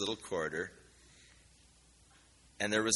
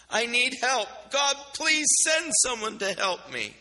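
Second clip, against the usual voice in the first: higher, louder desperate plea; pleading prayer; 13 semitones higher, 5 dB louder than usual. Retold or imitated. Girl begs God to send help, higher and pleading.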